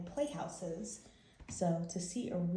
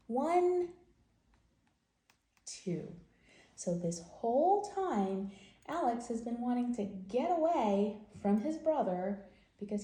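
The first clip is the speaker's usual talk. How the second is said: lively picture-book read-aloud voice; reading to children; 4 semitones higher than usual. Acted. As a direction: tell the story to little kids.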